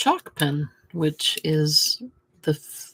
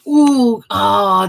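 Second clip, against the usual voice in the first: excited; 3 semitones higher, 10 dB louder than usual. Real, not acted. Excited discovery.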